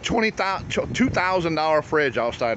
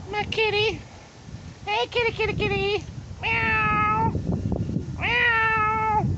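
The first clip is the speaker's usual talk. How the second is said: cat-calling voice; slower than usual. Real, not acted. Man calling his cat, very high baby talk. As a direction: coax the cat in a squeaky voice.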